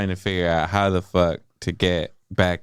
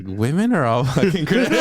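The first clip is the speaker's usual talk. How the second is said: laughing exasperation; 6 semitones higher, faster than usual. Retold or imitated. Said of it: "I'm just like".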